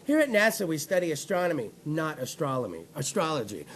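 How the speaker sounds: low, soft, condescending mock statement; smug, hushed correction, imitated; dickish NASA statement. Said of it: "nasa was a little bit of a dick about it".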